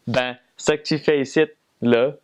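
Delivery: Quebec French, rising on ici; a Quebecer asking what you're doing here; Quebecois accent. Shown as a demonstration. Repeating the Quebec example, in a Quebec accent. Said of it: "the syntax of a Quebecois sentence".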